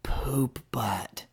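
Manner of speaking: unvoiced whispered "Poop butt". Wife whispering into the microphone. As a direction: breathe it into the microphone. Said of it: "and she says".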